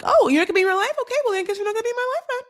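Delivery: high, fast mocking whine; sarcastic whiny mimicry; mocking both sides. Mocking the family standoff, high whiny sarcasm. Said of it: "they're both kind of doing this thing like".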